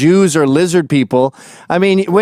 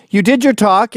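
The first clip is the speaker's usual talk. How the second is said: ordinary delivery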